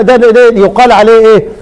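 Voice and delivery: ordinary talk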